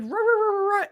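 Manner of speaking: high rolling dog noise; imitated dog bark. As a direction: trill out a frantic dog's alarm. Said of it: "and bark at me".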